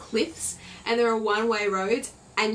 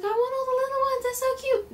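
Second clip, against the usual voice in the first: high, squealing over the little birds; high baby-talk gush at cute little birds; 13 semitones higher than usual. Retold or imitated. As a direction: coo like you're melting at tiny birds. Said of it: "I was like".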